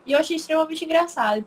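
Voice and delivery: ordinary delivery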